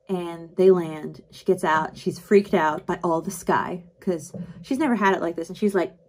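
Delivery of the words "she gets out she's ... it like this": normal talking voice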